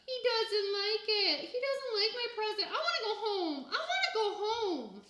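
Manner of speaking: high, loud, slow wailing sob; crying. A narrator's reading. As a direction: wail it through tears. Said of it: "Sam cried".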